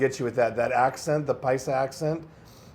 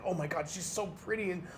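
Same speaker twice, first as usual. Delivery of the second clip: mock infatuated gushing; 9 semitones higher than usual. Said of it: "and it's like".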